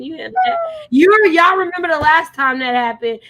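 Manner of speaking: loud, panicked shouting. Real, not acted. Shouting to her co-host while chasing a spider, panicked. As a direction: yell it out, scared of the spider.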